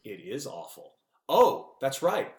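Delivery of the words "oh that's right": sarcastic. Acted. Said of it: "That doesn't make sense".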